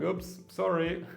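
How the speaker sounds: high, slow little "oops" apology; mock sheepish apology. Acts out own awkward apology.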